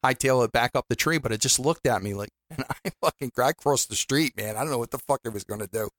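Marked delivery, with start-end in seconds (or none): none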